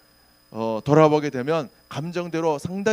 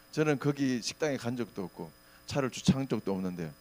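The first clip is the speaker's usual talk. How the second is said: normal talking voice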